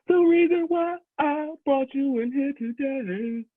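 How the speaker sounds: high, slow soulful croon of a boss firing you; high, slow crooned firing speech. Acted. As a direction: sing the firing like a smooth soul ballad.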